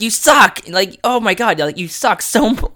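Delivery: high, loud retold griping at the cat; exasperated, not screaming. Man retelling telling off his cat, exasperated. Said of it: "not screaming at the top of my lungs".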